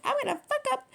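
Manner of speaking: high-pitched mock outburst; mock quote. Quoting someone in a troubled relationship, high-pitched mock quote. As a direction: squeak it out as a quote.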